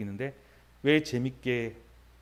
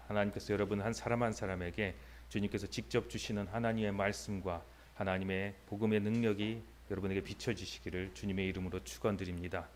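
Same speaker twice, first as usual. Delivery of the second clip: ordinary talk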